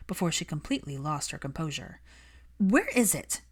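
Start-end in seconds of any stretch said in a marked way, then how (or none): 2.6-3.4 curt demand. Acted. Hermione demanding her cactus.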